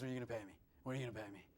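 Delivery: soft, low mock thought, said under the breath; inner thought. Candidate secretly wondering about pay, muttered softly. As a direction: voice the nagging thought quietly.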